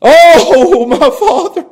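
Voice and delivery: high, wailed plea; sobbing prayer. Acted. Jesus makes his request to the Father, sobbing. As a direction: cry out with a sob. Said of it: "crying and sobbing and talking to the Father".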